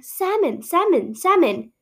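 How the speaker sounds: "salmon" repeated as "sammon", each falling; silent-L salmon. Retold or imitated. Mimicking the nature documentaries, "salmon" as "sammon". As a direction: chant "salmon" with no L. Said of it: "it was always like".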